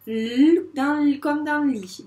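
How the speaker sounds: L held as a long lll, slowly into lit; letter sound demo. Shown as a demonstration. Teacher linking the letter to lit, held L sound. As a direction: stretch the L sound. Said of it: "il fait bien le son".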